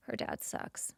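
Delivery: hushed confiding whisper; whispered aside. Real, not acted. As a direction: lean in and whisper it. Aside about cruel father.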